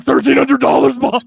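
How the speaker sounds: loud, high overexcited contestant shout; Lon swallowing the microphone. Retold or imitated. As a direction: blurt it right into the mic, way too excited. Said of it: "he's, like, swallowing the microphone".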